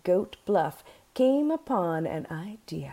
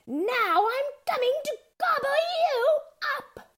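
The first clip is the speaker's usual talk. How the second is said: menacing troll. A narrator's reading. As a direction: threaten your prey in a high troll voice. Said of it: "menacingly muttered".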